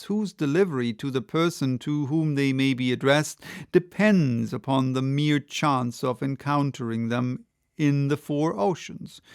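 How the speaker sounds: plain reading voice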